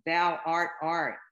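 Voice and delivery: slow loud declaration; yelling. Acted. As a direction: bellow it out slowly.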